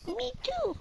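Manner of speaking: high squeaky silly voice. Pretend. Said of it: "squeaky ass voice".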